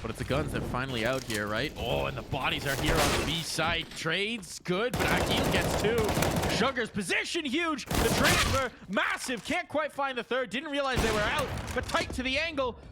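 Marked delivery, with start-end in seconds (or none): 1.8-12.8 much higher and louder, racing fast-paced caster call; excited play-by-play. Acted. Caster calling the round to the crowd, high and loud. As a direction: sell every kill at the top of the voice.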